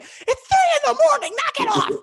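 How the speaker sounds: high, loud angry yell; angry shout. Retold or imitated. Neighbor woken at night yelling at the singer, furious. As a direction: yell at the noisy singer to shut up. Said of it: "clearly just pissed off".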